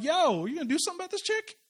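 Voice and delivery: high, fast, alarmed outburst; freaked out. Retold or imitated. Shocked guests at the dinner react to the weeping woman, fast and high. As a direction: blurt it like a scandalized onlooker. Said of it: "people are freaking out like".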